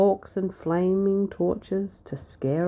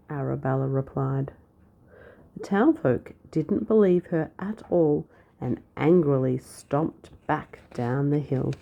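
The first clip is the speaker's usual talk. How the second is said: plain reading voice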